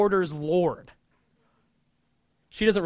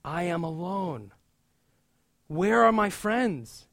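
lonely lament; slower than usual. Retold or imitated. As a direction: sigh out the loneliness. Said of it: "That's how Martha feels".

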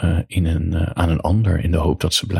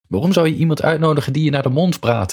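higher, quick call-out; calling after someone; 9 semitones higher, faster than usual. A narrator's reading. Narrator calls after a departing woman, quick high call. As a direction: throw the question after her, fast and raised in pitch. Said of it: "riep ik haar nog achteraan".

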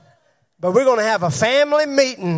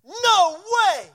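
much higher, shouted protest as Peter; 8 semitones higher than usual. Acted. Peter shouting his denial back at Jesus. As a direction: cry out in disbelief.

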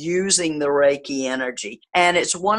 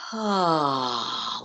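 audible exhale; 6 dB quieter than usual. Shown as a demonstration. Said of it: "very deep breath".

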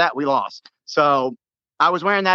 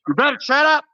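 projected voice; 8 semitones higher than usual. Retold or imitated. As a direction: throw the voice across the section. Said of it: "just projected my voice".

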